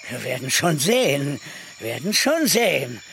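low and quick, with a veiled threat. Acted. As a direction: vow revenge under your breath. Cornelius vowing revenge. (tief und drohend)

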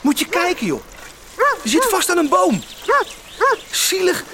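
high pitched coaxing to a stray dog. Acted. Boy talking to an abandoned dog, high and coaxing. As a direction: pitch up and coo at the poor tied-up animal.

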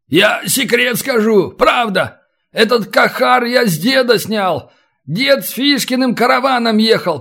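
high, urgent, animated confession; desperate prisoner in a high storybook voice; pleading captive bandit. Acted. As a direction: blurt out the secret to save your skin.

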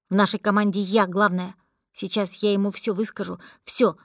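ordinary delivery

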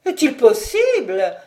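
loud, theatrical exclamation; showy, put-on amazement; declaimed astonishment. A narrator's reading. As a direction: declaim it like an affected lady. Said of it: "déclama mademoiselle Olive".

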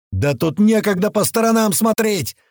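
high, indignant holler; exasperated yell. A narrator's reading. Корж snapping back over the earpiece, yelling. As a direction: yell back that there's no time. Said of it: "взорвался мой наушник воплем Коржа".

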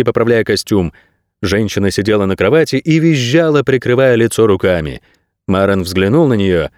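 usual speaking voice

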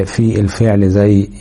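ordinary talk, nothing marked

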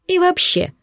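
high, sniffy interjection; a pompous countess winding up; haughty countess. A narrator's reading. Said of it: "не унималась тупоголовая".

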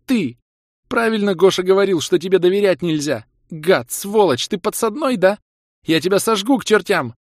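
high, loud raging tirade; high-pitched shouted threats; furious accusation. A narrator's reading. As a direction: rage at a traitor. Kira curses and threatens.

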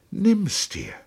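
high, imploring voice; pleading. A narrator's reading. Lieutenant begs the captain to accept, high and pleading. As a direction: beg him in a high voice. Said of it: "flehte Trotta".

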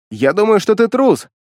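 shouting. Acted. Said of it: "крикнул я".

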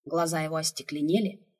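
usual speaking voice

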